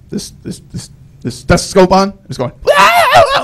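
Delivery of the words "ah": piercing high pterodactyl shriek; very high, ear-splitting screech; grandmother screaming. Retold or imitated. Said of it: "she starts screaming".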